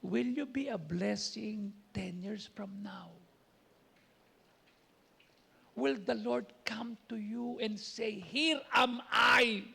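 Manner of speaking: slow, drawn-out preaching, swelling on Here am I; preaching challenge. Acted. Preacher asks the congregation if they bear fruit, slow and earnest. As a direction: lean in and challenge the flock slowly.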